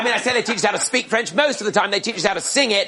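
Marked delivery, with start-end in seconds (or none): none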